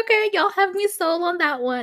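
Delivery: high, sing-song approval; high-pitched won-over reaction. Retold or imitated. Host quoting own reaction to the pictures, high-pitched. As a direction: say it up high, sold.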